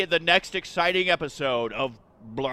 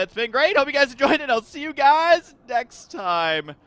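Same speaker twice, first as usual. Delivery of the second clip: outro voice; 7 semitones higher, 4 dB louder than usual. Acted. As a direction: drag out the goodbye. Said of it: "I can't even do it with a straight face".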